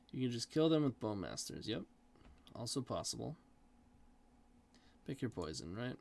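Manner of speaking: ordinary talk, nothing marked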